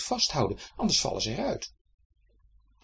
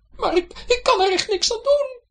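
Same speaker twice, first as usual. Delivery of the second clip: very high, tearful pleading wife; plead, close to tears; a sob in her voice; 9 dB louder than usual. A narrator's reading. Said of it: "met een snikje in haar stem". (hoog, met een snik in de stem)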